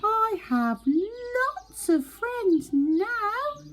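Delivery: high, slow, drawn-out happy call across the pond; calling out very loudly. Acted. Little Beaver calling his joy across the pond, slow loud high call. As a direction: shout it out slowly so it carries across the water. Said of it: "very loudly".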